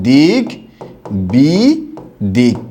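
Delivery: "দিক বি দিক" said slowly, syllable by syllable; "দিক বি দিক" with a clear pause after "দিক"; syllables said apart. Shown as a demonstration. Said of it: "এরকম হবে".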